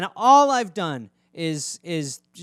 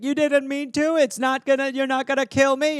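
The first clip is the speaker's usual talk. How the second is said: high pet voice; a hint of a wary cat; forgiving cat; 7 semitones higher than usual. Cat answering the apologizing owner, higher pet voice. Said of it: "your cat's like".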